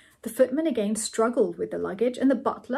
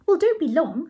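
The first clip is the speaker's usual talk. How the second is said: high, louder character voice; Ethel's voice; 7 semitones higher, 6 dB louder than usual. A narrator's reading. Ethel sends him upstairs, high insistent voice.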